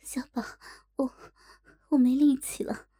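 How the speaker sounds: panting, half-whispered words; out of breath, trailing into a breathy whisper; breathless. A narrator's reading. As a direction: gasp the words out after the exertion. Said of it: "喘息着说道".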